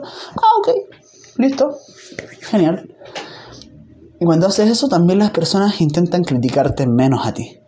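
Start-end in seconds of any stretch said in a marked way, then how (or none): 0.0-2.9 high, light acted okay; breezy acceptance. Coach answering a client's excuse, breezily accepting. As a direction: accept the excuse in short, high-pitched bursts.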